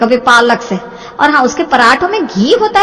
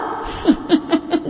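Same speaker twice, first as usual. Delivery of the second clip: mocking laugh; 6 semitones higher, 8 dB quieter than usual. Mother-in-law laughs at threat.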